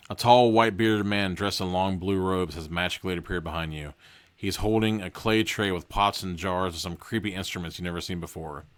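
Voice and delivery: plain reading voice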